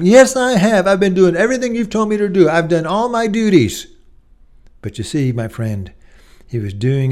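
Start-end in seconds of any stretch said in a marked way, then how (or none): 0.0-3.9 much higher and louder, fast self-defense; older brother defending his duty to his father, indignant. Acted. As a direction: insist on your dutiful record.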